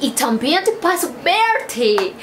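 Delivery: put-on gringa accent in broken Spanish; Spanish with an American accent. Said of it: "Aunque habla como gringa".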